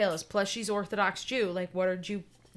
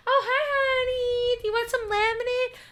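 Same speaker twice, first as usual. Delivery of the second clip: sugary mom voice; 7 dB louder than usual. Retold or imitated. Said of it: "So Jamie's gonna".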